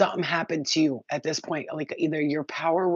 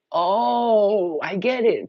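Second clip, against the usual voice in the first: sudden realization; 5 semitones higher, 7 dB louder, much slower than usual. Retold or imitated. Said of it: "I'd be like".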